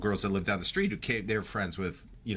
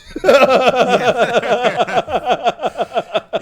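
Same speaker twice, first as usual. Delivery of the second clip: big burst of real laughter, much higher and louder; 13 semitones higher, 14 dB louder than usual. Real, not acted. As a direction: let the laugh explode. Reacting to the deal story.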